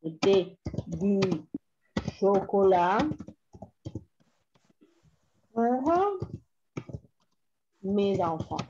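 word by word, long pauses; dictating while writing. Shown as a demonstration. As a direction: say each chunk as you write it down.

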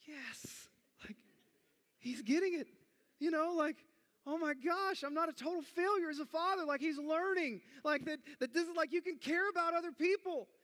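overjoyed that his son learned kindness; proud relief. Retold or imitated. Proud dad relieved about son.

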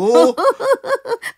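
high cackling laugh. Acted. Master laughs.

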